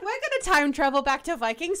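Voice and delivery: loud, high playful exclamation; mock excitement. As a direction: shout it like gleeful cosplayers. Voices excited cosplaying friends.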